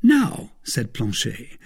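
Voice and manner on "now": high-pitched servant voice. A narrator's reading. Servant proposes next step to master, high voice. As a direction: pipe up to your master.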